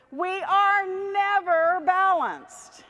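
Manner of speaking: high, loud, drawn-out declaration; rousing proclamation. Real, not acted. Speaker rallying audience after balance exercise, loud emphatic proclamation. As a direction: belt it out slowly to the whole room.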